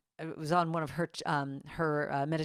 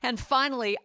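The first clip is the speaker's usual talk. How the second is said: louder and higher, building to a triumph; Niecy Nash's triumphant speech; 5 semitones higher, 5 dB louder than usual. Retold or imitated. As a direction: announce the big finish with pride. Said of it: "She said".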